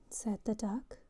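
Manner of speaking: ordinary delivery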